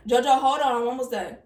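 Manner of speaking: loud call to someone nearby. Real, not acted. Reader calls to JoJo off camera, loud. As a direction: call across the room.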